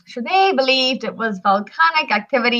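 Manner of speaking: ordinary talk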